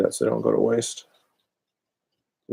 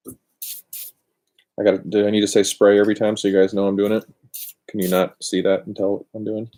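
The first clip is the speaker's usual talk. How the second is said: normal talking voice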